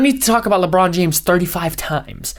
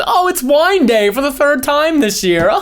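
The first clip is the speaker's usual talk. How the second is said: higher, slow, hammed-up cheery announcement; mock perky DJ; 8 semitones higher, slower than usual. Mocking radio hosts' positive filler, hammed-up perky read. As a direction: oversell a silly survey like a chirpy host.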